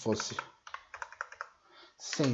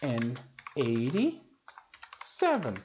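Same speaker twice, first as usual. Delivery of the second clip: eighty-seven drawn out very slowly, syllable by syllable; teacher slowing eighty-seven down for the class; slow model pronunciation; 3 semitones lower, much slower than usual. Shown as a demonstration. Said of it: "como que eu falo?".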